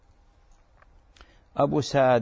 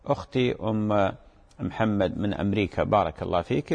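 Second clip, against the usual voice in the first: usual speaking voice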